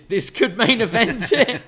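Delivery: loud, high laughing line. Real, not acted. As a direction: laugh it out amused. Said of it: "Listen to us".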